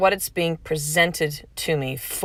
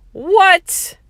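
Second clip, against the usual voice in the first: sudden high loud outburst; indignant what; 12 semitones higher, 6 dB louder than usual. Retold or imitated. Recalling her old ego reacting to a prayer. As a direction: blurt it out in disbelief.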